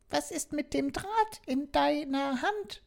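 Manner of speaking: very high, soft doll voice; a curious little talking doll; Bonnie asking. Acted. Doll asking the robot about the wire, very high and soft. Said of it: "fragte Bonnie".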